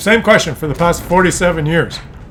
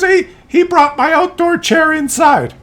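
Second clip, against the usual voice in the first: put-on higher, very slow, halting old lady voice; slow old mother; 9 semitones higher than usual. Mother explaining the chair to her son, slow high halting voice. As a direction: stumble through it slowly.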